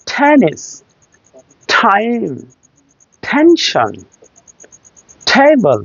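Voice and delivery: "টেনিস, টাইম, টেনশন, টেবল", each t released with a puff; high, separated model words "টেনিস, টাইম, টেনশন, টেবল" with airy t; aspirated English T. Shown as a demonstration. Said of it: "টি না, ঠি".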